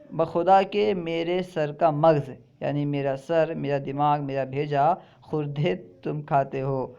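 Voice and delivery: ordinary talk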